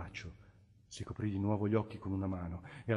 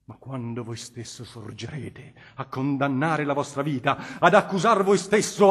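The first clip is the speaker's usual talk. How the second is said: put-on impassioned old bishop exhorting, rising louder at the peaks; cardinal pleading; 5 semitones higher, 9 dB louder than usual. Cardinal Federigo urges the Innominato toward repentance, impassioned. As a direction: preach mercy to a hardened sinner with growing fire. Cardinal's long plea to sinner.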